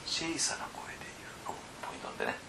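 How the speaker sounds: hushed, whispered teaching point; small voice. Shown as a demonstration. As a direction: drop to a whisper so listeners lean in. (小さな声でささやくように)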